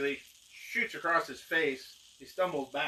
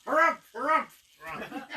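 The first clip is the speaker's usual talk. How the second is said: blustering harumph; 13 semitones higher, 7 dB louder than usual. Acted. Reacting to the magician's grand speech, blustering harumphs. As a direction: huff pompously, tailing off.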